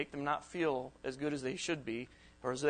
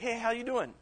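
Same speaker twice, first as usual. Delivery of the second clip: light, higher friendly hello; cheery text greeting; 7 semitones higher than usual. Retold or imitated. Speaker voicing a friendly text to a friend, higher and upbeat. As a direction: sound upbeat, reaching out.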